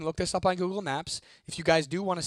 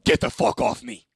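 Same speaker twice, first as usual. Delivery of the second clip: harsh, voiceless loud rasp; evil turn, loud throaty whisper; imitating sudden menace; 5 semitones lower, 6 dB louder than usual. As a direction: rasp it out forcefully, like someone possessed. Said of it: "she transitions from, like, scared to".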